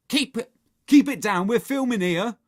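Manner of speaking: irritated shout. Acted. Henry scolds next door while filming, shouting. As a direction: shout down the noisy neighbours.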